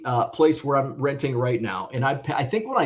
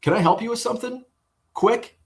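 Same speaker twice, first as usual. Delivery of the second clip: mocking the manager; 9 semitones higher than usual. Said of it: "he goes".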